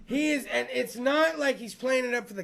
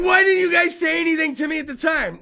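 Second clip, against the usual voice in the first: exasperated shout; 7 semitones higher, 9 dB louder than usual. Retold or imitated. Comic yelling at the rock band backstage. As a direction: yell the question at them like it's been bugging you for years. Retold outburst at band members.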